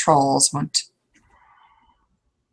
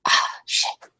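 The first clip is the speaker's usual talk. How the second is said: whispered curse; 4 dB quieter than usual. Acted. Character startled by phone.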